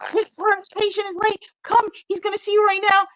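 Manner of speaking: high, quick eager voice; excited girl's voice. Retold or imitated. Store girl rushing her in, high and eager. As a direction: hurry the words out like a thrilled helper. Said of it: "She's like".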